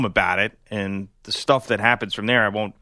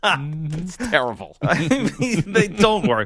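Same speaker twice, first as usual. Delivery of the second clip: laughing; 4 semitones higher, much slower than usual. Real, not acted. Laughing protest.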